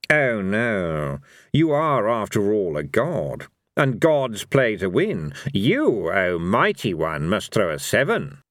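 slow, lordly character voice; lofty, unhurried taunt; Fate, a mocking god. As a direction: draw the words out with mock reverence.

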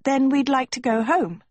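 put-on high-pitched, girlish reply; Sophie. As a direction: answer in a high, let-down girl's voice. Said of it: "Sophie said".

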